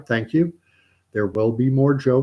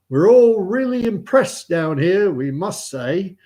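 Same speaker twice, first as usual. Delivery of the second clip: slow, raised, loud intoning; praying chaplain; 8 semitones higher, 6 dB louder, slower than usual. Acted. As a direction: intone it ponderously as a chapel prayer. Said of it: "the vicar or priest or chaplain".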